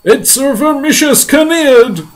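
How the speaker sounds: high, horrified, drawn-out shout; crying out. Acted. Police chief horrified by the spider's face, high cry. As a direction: shout in horror, stretching every word. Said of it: "cried the chief of police".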